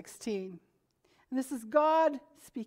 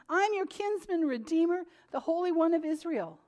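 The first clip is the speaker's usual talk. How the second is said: higher, raised pulpit reading; fervent sermon read; preaching scripture; 7 semitones higher than usual. Acted. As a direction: call out the verse to the congregation. Preaching God's promise.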